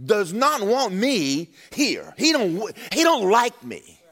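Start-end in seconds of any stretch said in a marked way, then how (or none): none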